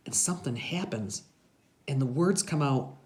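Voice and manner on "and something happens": plain reading voice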